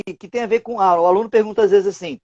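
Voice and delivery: ordinary delivery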